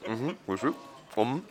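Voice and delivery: chewing while humming agreement; mumbled through a mouthful; mouth full. Real, not acted. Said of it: "Mit vollem Mund".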